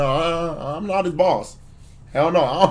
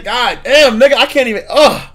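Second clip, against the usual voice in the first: higher and louder, overwhelmed groan; exasperated outburst; 8 semitones higher, 5 dB louder than usual. Real, not acted. Streamer reacting to an awful clip, exasperated loud outburst. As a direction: blurt out your disbelief.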